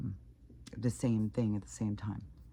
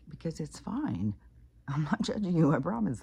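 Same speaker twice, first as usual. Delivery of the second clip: higher-pitched, insistent reassurance with a little laugh; retold reassurance; 6 semitones higher, 4 dB louder than usual. Retelling how she told her she isn't judging, insistent reassurance. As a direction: reassure insistently, replaying your side.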